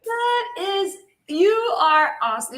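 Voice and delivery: high thrilled voice; excited. Real, not acted. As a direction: gush with excitement. Reacting to the winner.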